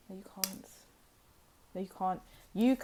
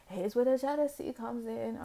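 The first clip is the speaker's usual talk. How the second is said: same line again, higher and theatrical; dramatic reveal; 4 semitones higher than usual. Shown as a demonstration. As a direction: tease the juicy part like a gossip.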